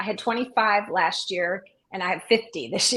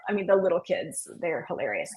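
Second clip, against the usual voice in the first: usual speaking voice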